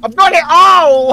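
high loud yelp, laughing; excited shout. Real, not acted. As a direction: yell then cry out in pain. Hit during fight.

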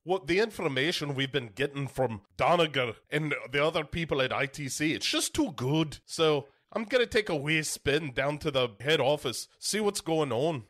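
put-on Scottish professor explaining; Billy Connolly. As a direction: carry on in a mock Scottish accent. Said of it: "Billy Connolly hands Paul Walker".

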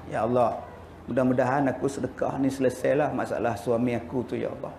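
low, soft prayer voice of a wife; a hint of a wife praying under her breath; mock pleading prayer. Wife's mock prayer at the donation box. (doa isteri yang rendah dan perlahan)

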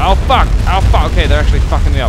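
loud, fast cursing under fire; swearing loudly as the tank gets hit; frantic shouting. Real, not acted. Gamer yelling while enemies shred his tank. Tank taking heavy damage.

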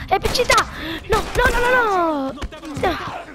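high panicked yells; panicked shout. Real, not acted. Panic in the game.

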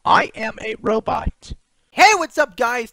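edited, pitch-corrected robot voice; robotic voice. Shown as a demonstration. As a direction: speak like an autotuned robot. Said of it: "an edited robotic voice of me".

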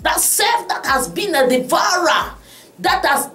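fervent prayer. Acted. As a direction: plead with God for deliverance. Leads congregation in prayer.

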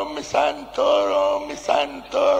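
high, drawn-out whining cry; mock-whiny woman pitched far up, stretching each phrase; woman complaining. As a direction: moan it high and slow like a sulking woman. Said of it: "la comincia la fa".

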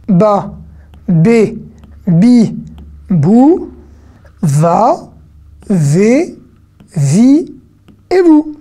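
BA, BÉ, BI, BOU recited one by one with held vowels; teacher's syllable drill, VA, VÉ, VI stretched and lifting at the end; syllable recap. Shown as a demonstration. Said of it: "On récapitule?".

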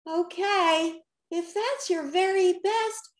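high-pitched, doubtful character voice. A narrator's reading. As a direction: go along with it, but sound unconvinced. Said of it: "Rocky said doubtfully".

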